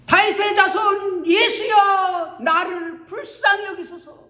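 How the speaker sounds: high, loud shout, slowed and stretched. Acted. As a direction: shout for mercy even harder. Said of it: "더욱더 큰 소리로".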